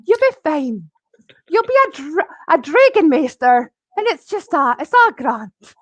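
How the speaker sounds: calm her down like a kind dowser; reassuring gentleman. Retold or imitated. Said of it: "he went".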